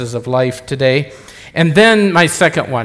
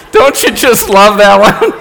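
louder, laughing through the words; laughing delight; 6 semitones higher, 8 dB louder than usual. Real, not acted. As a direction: share the joke, still laughing. Laughing after punchline.

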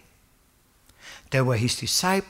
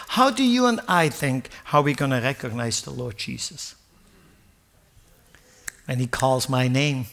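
ordinary delivery

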